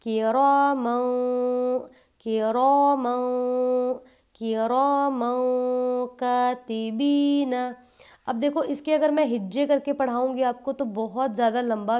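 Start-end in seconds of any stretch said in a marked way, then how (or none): 0.0-7.7 किरामन stretched very slowly, nasal न; with ghunna. Shown as a demonstration. Teacher demonstrates the tanween ikhfa to students, slow and nasal. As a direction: chant किरामन कातिबीन slowly through the nose. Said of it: "तो किस तरह से पढ़ेंगे".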